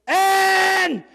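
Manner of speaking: high, loud answering yell; group shout back. Retold or imitated. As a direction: echo it back like a crowd. Classmates answer in unison.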